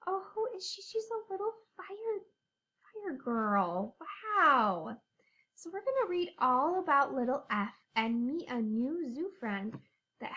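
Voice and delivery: ordinary talk, nothing marked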